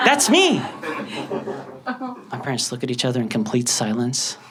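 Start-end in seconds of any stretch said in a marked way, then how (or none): 0.1-0.6 high-pitched proud exclamation; excited. Acted. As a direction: announce yourself like a thrilled kid. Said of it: "I was so excited to tell my parents".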